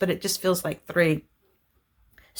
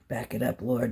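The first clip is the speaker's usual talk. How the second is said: low aside to self; 7 semitones lower, 8 dB quieter than usual. Real, not acted. Scolding herself for rushing.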